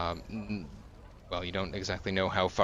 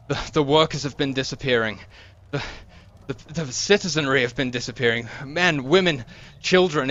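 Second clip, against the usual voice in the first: higher and louder, clinging and desperate; terrified guard; 4 semitones higher, 5 dB louder than usual. Acted. Guard clinging to the breastplate, terrified, begging for help. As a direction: plead like he is terrified. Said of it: "looking terrified".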